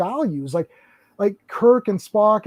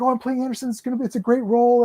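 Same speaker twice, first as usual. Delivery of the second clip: higher, lightly gushing excitement; excited; 6 semitones higher, faster than usual. Retold or imitated. Actress talking about her new role, retold excitement. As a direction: imitate an eager performer, pitched up and quick. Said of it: "She's so excited".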